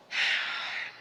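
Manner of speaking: dad's long exhale, imitated; imitated sigh. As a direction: sigh softly as Dad would. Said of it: "he goes".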